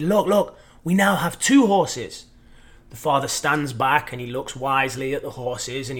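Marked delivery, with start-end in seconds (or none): 0.0-2.3 eager, higher, bright-eyed announcement; thrilled child showing off the new horse; excited. Retold or imitated. Son excitedly showing his father two horses. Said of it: "he's so excited".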